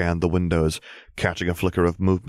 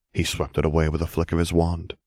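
plain reading voice

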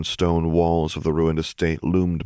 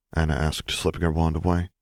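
plain reading voice

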